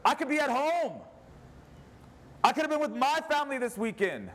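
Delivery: a preacher voicing Moses begging the people to obey, high and impassioned; voicing Moses pleading. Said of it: "I'm tired of feeling like Moses and saying".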